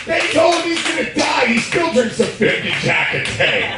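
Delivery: high, loud shouted rant; hyped shout. Real, not acted. Comic hyping [name]'s hard drinking to the crowd, high hyped shout. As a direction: yell it in amazed admiration.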